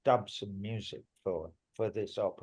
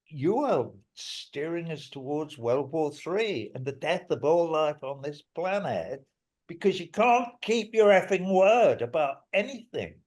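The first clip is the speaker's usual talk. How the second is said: raised, heated tirade; angry rant; 6 semitones higher, 5 dB louder than usual. Real, not acted. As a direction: let the anger rise and push it out. Ranting at Western governments.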